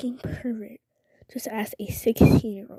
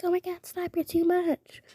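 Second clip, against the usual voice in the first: cutesy; 9 semitones higher than usual. Retold or imitated. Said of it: "I try to be all cutesy".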